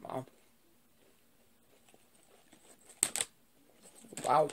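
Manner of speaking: chewing while talking; talking through food; mouth full. Real, not acted. Seen 1: Chip put in mouth at -4; closed-mouth chewing, finger licking during.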